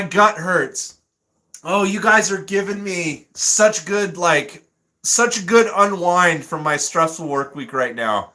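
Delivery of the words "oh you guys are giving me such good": ordinary delivery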